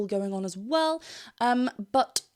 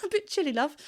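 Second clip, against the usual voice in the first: light, higher posh British voice; mock posh British accent; 5 semitones higher than usual. Coach teasing viewers about her posh British accent. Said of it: "I sounded so British just then".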